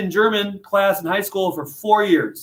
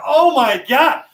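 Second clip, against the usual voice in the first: mock alarm; 8 semitones higher, 4 dB louder, slower than usual.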